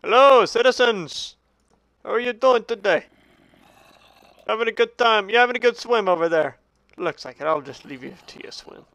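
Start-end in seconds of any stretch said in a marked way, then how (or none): 0.1-6.6 high-pitched, cheery public greeting; grand hailing. Pretend. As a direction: greet your citizens like a jolly ruler. Hails game creatures as citizens.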